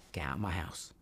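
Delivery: low, soft Cockney rehearsal; practising under breath. Retold or imitated. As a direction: run the line quietly to yourself. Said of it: "practicing my lines and my breath".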